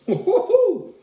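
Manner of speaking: high burning whoop, woo-hoo-hoo; excited whoop. Real, not acted. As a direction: whoop from the spicy burn. Hot sauce burn hits.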